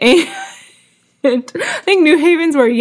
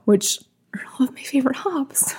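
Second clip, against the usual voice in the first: whispering; 10 dB quieter than usual. Real, not acted. Whispered aside about favorite hops.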